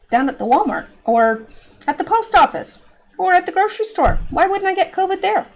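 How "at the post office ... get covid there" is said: incredulous. Real, not acted. Incredulous rhetorical questions.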